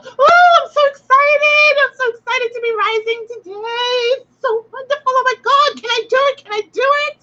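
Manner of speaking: squealing high, over-the-top excitement; mock excited sun. Teacher mocking a sun squealing with excitement, hammed-up high voice. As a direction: ham up a giddy sun about to rise. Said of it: "does the sun rise and go".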